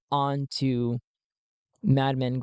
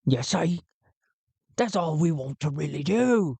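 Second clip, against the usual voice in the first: pirate pleading his case; 3 semitones higher than usual. Acted. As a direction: argue it as simple, in pirate character. Gotul states his case.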